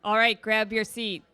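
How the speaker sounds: loud call to the room. Real, not acted. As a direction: call the crowd to their seats, loudly. Calling the audience to sit down.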